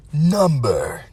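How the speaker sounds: mock announcer. Vlogger announcing the next countdown entry, mock announcer. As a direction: stretch each word slowly like an announcer.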